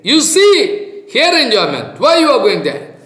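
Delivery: high, loud call of caution; stern warning. Acted. Guru warns the seeker away from pleasure, loud and high. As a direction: warn the wanderer sharply. Guru's warning voiced in story.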